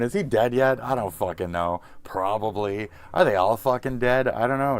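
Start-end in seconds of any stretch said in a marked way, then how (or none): 0.0-0.8 mock viewer voice. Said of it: "I'm going". 3.1-4.8 exasperated. Real, not acted. Loud exasperated exchange.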